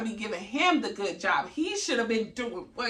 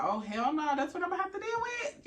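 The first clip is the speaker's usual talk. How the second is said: mock dismay; 8 semitones higher than usual. Mother hearing newborn cry, mock dismayed protest. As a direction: pitch climbing high in comic disbelief.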